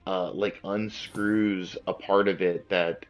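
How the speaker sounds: ordinary talk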